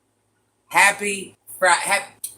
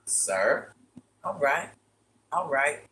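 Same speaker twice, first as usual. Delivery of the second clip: low, quiet resigned acceptance; low and soft, taking it in grudgingly; muttered, unimpressed going-along; deadpan reply; 4 semitones lower, 5 dB quieter than usual. Retold or imitated. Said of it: "I'm like".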